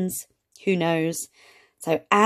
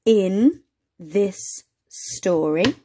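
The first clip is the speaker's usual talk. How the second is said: slow reading; much slower than usual. Shown as a demonstration. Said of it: "so in this story we've got".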